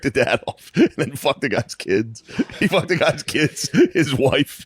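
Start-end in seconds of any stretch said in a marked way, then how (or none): 2.6-4.7 slow, laughing disbelief. Real, not acted. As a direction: say it while laughing. Laughing over story.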